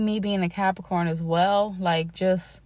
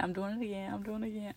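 trailing off much softer, half to herself; fading self-scold; 11 dB quieter, faster than usual. Real, not acted. Rambling podcaster catching herself, fading out. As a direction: let it die away as she catches herself.

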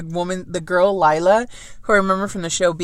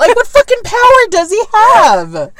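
exasperated rant; 12 semitones higher, 8 dB louder than usual. Real, not acted. Ranting about the book.

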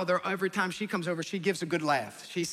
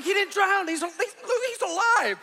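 high, loud, strained panic; a dad's frantic high-pitched backpedal; panicked squeal; 13 semitones higher, 5 dB louder, slower than usual. Retold or imitated. Dad panicking to wife.

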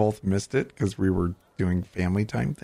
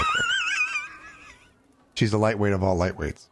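laughing. Real, not acted. Teasing Gina, laughing.

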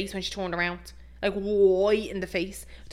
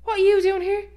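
high, loud squeak of surprise; voice shooting up; 12 semitones higher, 7 dB louder than usual. Retold or imitated. Dad surprised by a visit, voice shooting up. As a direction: imitate a dad's voice leaping up. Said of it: "his voice goes up about ten octaves".

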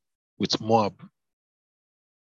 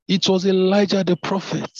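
much higher, louder exclamation of recognition; exclaimed; 9 semitones higher, 4 dB louder than usual. Shown as a demonstration. King recognising the prophet, exclaiming in alarm. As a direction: cry out the name as the king realises who it was. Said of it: "I'll take that again".

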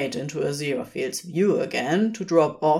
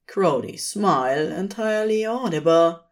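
ordinary delivery

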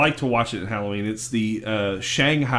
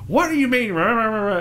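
high, sputtering mock complaint; mock ranting; 9 semitones higher than usual. Squidward demanding answers from the Dutchman, high mock rant. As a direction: imitate someone going off, words dissolving into noise. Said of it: "Squidward just goes off".

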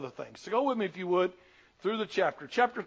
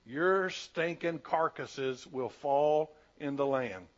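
slow judgment; 4 semitones lower, much slower than usual. Acted. God's judgment read slowly.

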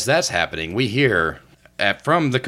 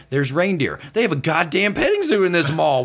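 ordinary delivery

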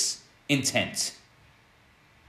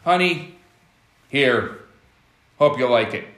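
offhand toss; 4 semitones lower than usual. Retold or imitated. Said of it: "tosses the gift on the table".

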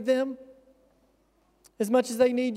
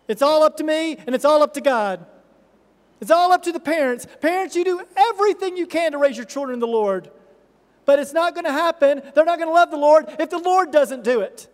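higher, louder preacher's fervor, driving the point home; full-force preaching; 5 semitones higher, 6 dB louder than usual. Acted. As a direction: pastor urging parents, preaching at full force.

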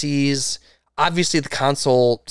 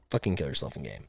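much softer and lower throwaway; muttered aside; 9 semitones lower, 13 dB quieter, faster than usual. Acted. Host to cheaters, a muttered quiet repeat. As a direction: mumble it under your breath like an afterthought.

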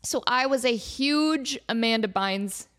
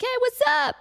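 perky photo pose; 14 semitones higher, 4 dB louder than usual. Retold or imitated. Posing for a photo.

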